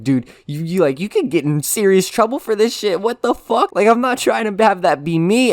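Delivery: high, loud disbelief; pleading with a giggling friend in alarm; panicked scolding. Retold or imitated. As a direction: warn him he'll get in trouble.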